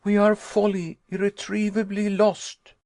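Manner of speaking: high, loud and desperate; despairing cry. Acted. Nephew telling uncle they are doomed, high panicked cry. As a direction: wail that all is lost. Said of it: "I cried".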